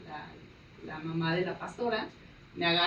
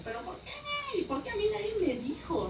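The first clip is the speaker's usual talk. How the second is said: very high, stretched mock wail; hammed-up aggrieved complaint; retold indignation; 14 semitones higher than usual. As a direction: protest in a high, whiny voice. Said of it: "Porque yo estaba muy enojada".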